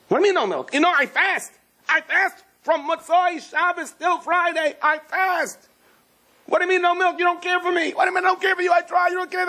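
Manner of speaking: very high, hammed-up shouting match; frantic quarrel. Husband and wife fighting over no milk, frantic high yelling. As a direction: argue hysterically at the top of your range.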